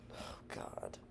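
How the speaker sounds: whispered, breathy aside; exasperated whisper. Retold or imitated. Man recalls being asked his race, exasperated whisper. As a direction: sigh it out under your breath.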